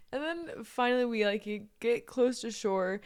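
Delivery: ordinary talk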